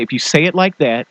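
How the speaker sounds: normal talking voice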